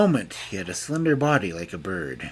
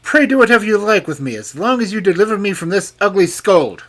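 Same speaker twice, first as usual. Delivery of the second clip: fast, loud, gleeful king; gaily; 11 semitones higher, 10 dB louder, faster than usual. A narrator's reading. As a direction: toss it off cheerfully. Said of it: "the king cried gaily".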